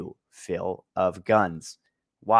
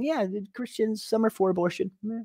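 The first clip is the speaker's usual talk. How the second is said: higher, softer mock voice of Phil; mimicking Phil to mock his curveball, higher and softer; 10 semitones higher, 3 dB quieter than usual. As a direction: sneer out Phil's sly claim in a put-on higher voice. Mocking Phil's slippery claim.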